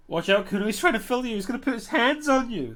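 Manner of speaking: high, loud mock-panicked warning; shrill squealing. Creature behind fence warning Kuno, shrill squealing. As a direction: squeal it out in rising alarm. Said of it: "starts squealing, shrill and violent".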